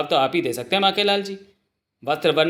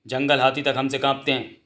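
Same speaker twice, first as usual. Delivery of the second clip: ordinary delivery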